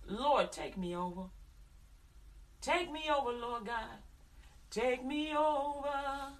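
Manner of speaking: slow, pleading prayer, "Take me over" repeated; fervent prayer. Acted. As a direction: pray it slowly, surrendering. Praying aloud to God.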